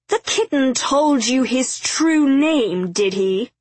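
high, slow taunting villain; gleeful mockery. A narrator's reading. Dervish mocks X over the name, gleeful. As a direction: hoot it with mocking glee. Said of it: "Dervish hooted with glee".

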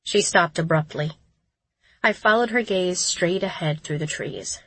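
ordinary delivery, nothing marked

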